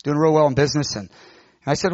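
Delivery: ordinary talk, nothing marked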